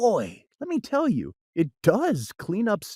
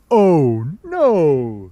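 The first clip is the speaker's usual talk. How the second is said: lower, louder cat voice; the cat, unruffled; 4 semitones lower, 7 dB louder than usual. Acted. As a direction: wave off their worry, low. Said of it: "said the cat".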